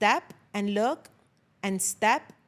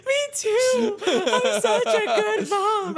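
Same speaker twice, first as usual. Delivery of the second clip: high, loud, slow self-congratulation; smug self-praise; 11 semitones higher, 5 dB louder, slower than usual. Retold or imitated. Mom bragging to her husband, high and slow. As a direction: preen in a high put-on voice.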